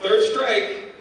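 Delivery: high, loud, slowed retold ultimatum; exasperated warning. Lord British gives the thief a last warning, loud and exasperated. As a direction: spell out the strikes forcefully.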